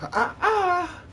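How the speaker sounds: a quick high-pitched giggle; high laugh. Real, not acted. Laughing at the dust on the sill, high giggle.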